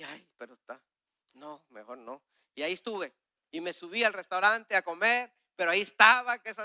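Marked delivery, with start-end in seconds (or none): 0.0-2.2 hesitant murmur. Retold or imitated. Hesitating to approach the man.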